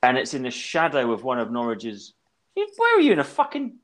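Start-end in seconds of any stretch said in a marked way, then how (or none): 2.6-3.8 loud, high, fast disbelief; incredulous outburst. Real, not acted. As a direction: blurt it out in disbelief. Startled by birds in background.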